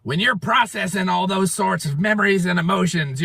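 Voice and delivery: usual speaking voice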